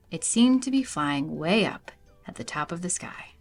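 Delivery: plain reading voice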